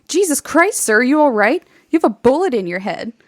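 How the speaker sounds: urgent, wide-eyed soldier's shock; alarmed radio operator. Retold or imitated. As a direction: blurt it out in acted alarm.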